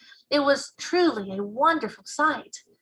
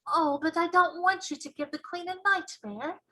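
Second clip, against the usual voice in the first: put-on girl voice; 8 semitones higher than usual. Sophie protests.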